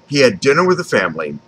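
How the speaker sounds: plain reading voice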